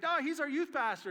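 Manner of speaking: put-on high, loud protesting kids; students protest. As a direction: jump in to defend the pastor. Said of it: "They're like".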